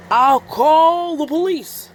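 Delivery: high, loud, slow turtle voice; Mister Turtle. Acted. Mister Turtle offers help, slow high urgent voice. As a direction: drag it out, urgent but slow. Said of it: "added Mister Turtle".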